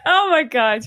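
loud, high burst through laughter; real laugh spilling into the words; laughing reaction. Real, not acted. Reacting to cohost's brave accent attempt, laughing.

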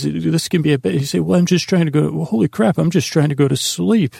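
usual speaking voice

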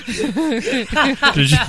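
high, laughing disbelief. Real, not acted. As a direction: laugh through the question.

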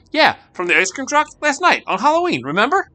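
pushing him to remember; eager insistence. Acted. Girl presses the crossing guard about the clowns, loud eager insistence.

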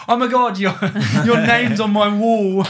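high, loud, gushing excitement; excited. Retold or imitated. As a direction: squeal like a thrilled student. Students' excited messages, retold.

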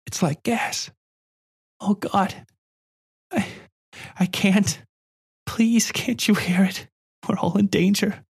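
put-on high child voice, breathless with fear; a scared girl begging to be heard; frightened little girl. A narrator's reading. Girl panics about gas.

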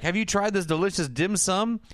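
high, rising sugary offer; high, sweet offer. Retold or imitated. Dad offering food to the coach, high and sugary. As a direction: sell the dim sum sweetly. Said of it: "he's like".

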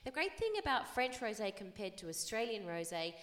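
ordinary talk, nothing marked

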